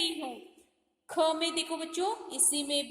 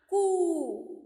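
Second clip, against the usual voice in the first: कू drawn out and louder; loud long ू; 2 semitones higher, 5 dB louder than usual. Shown as a demonstration. Teacher demonstrating the long u sound to class, loud. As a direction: stretch the long ऊ in कू. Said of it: "दूसरा जो उकार है ना".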